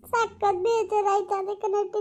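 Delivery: slow, drawn-out wail; crying lament. Acted. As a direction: stretch each word out through tears. Daughter crying over collapsed mother.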